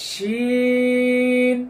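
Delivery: long sustained শিন, open sh sound; Arabic letter name. Shown as a demonstration. Teacher models শিন for students, slow recitation. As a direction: stretch শিন, teeth apart. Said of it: "জিহ্বার মধ্যখান".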